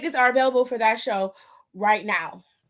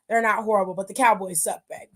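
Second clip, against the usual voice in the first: normal talking voice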